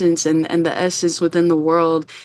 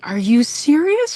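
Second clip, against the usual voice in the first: higher-pitched incredulous question, retold; shocked; 8 semitones higher than usual. Guest retells replying to her friend, shocked. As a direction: imitate your own disbelief, voice lifting. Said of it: "I was shocked".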